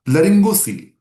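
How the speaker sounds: calls out the term high and loud; loud announcement. Real, not acted. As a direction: announce the new topic loudly to the class. Loudly announcing the next question.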